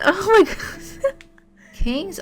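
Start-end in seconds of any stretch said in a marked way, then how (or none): 0.0-0.8 high-pitched, laughing disbelief; laughing exclamation. Real, not acted. Streamer overcome by a surprise raid, laughing.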